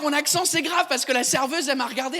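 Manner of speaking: ordinary talk, nothing marked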